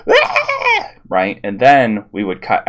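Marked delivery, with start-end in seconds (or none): 0.1-0.9 high, loud cartoon scream, laughing; mock yell. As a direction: ham up a screaming Homer. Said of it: "He goes".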